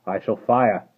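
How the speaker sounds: guard threatening the rider; roaring challenge. Acted. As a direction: roar the challenge. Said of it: "as loud as he could roar".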